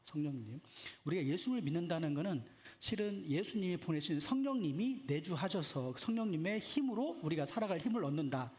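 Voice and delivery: ordinary delivery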